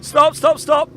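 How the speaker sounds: loud, high call to halt; shouting stop. Real, not acted. Calling time at contest end.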